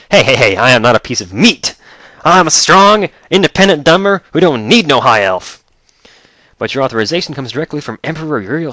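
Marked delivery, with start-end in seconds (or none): 0.1-5.6 loud, high sassy protest; hammed-up offended yell; comic outraged shout back; mock indignant. Indignant player retort.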